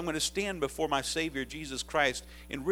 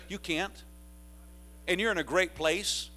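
ordinary delivery

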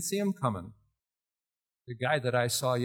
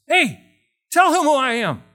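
louder, higher urgent plea; urgent loud appeal; urgent call; 11 semitones higher, 11 dB louder than usual. Retold or imitated. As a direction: grab his arm and call out. Grabbing the guy's arm.

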